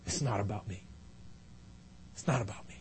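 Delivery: very soft, low confession; hushed humility. Acted. Nehemiah asks for God's glory, not his own, hushed humility. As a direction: drop down to a humble near-murmur. Humble close of acted prayer.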